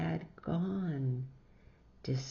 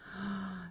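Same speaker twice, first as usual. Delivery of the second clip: gasp; 3 semitones higher, 7 dB quieter than usual. A narrator's reading. Children see antlered snow pigs.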